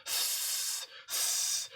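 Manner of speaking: long soft unvoiced hiss; quiet sustained serpent hiss; snake hiss.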